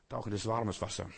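ordinary talk, nothing marked

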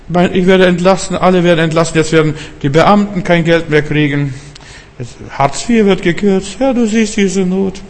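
high-pitched acted plea of a worried believer; pleading prayer. Believer praying about layoffs and benefit cuts, high pleading. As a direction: put on a high, anxious praying voice.

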